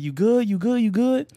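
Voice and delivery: high, rising you good checks; urgent concerned friend calling out; worried friend. Retold or imitated. Homie checking on him after the fall, high and urgent.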